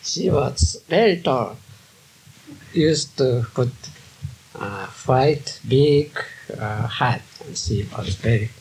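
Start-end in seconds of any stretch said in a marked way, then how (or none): none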